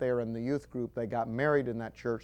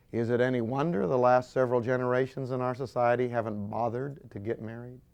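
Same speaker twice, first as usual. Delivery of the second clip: ordinary talk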